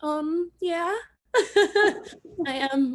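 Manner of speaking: laughing through tears. Real, not acted. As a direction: laugh it out while admitting it. Laughing through tears after messages.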